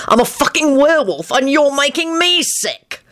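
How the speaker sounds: high, indignant protest; yelled. A narrator's reading. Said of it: "he yelled".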